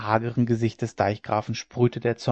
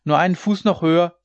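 higher, louder rallying cry; shouted encouragement; 5 semitones higher, 6 dB louder than usual. A narrator's reading. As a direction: urge tired workers on from above. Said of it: "schrie er zu ihnen hinab".

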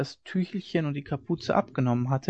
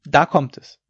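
loud announcement; calling out; 7 dB louder than usual. A narrator's reading. Father announces the coming thunder, calling out. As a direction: call it out over the wind. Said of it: "rief Hauke".

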